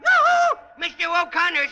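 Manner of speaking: loud high sing-song call across the street. Acted. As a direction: hail him from far away.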